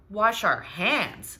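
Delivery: slow, drawn-out disbelief; incredulous. Acted. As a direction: repeat it as if absurd. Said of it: "said Papa".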